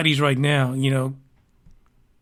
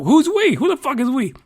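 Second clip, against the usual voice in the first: indignant; 7 semitones higher than usual. Real, not acted. Indignant outburst at quote.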